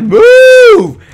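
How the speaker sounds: very high, loud, drawn-out moo; exaggerated cow call, shooting up; imitated cow. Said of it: "You'd think they'd be like".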